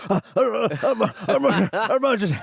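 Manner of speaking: high, loud mock stammer; struggling to say it. Mocking co-host as a tongue-tied emergency caller, panicked stammer. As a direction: splutter helplessly before the word comes out. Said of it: "Just cannot form the word emergency".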